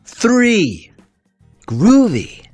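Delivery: ordinary delivery, nothing marked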